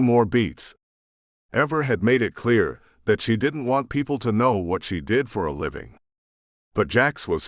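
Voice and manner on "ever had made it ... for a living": ordinary delivery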